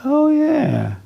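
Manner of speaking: high mock wife voice; high hammed-up wife saying oh yeah; imitating wife. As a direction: mimic the wife's breezy reply. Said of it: "And she says".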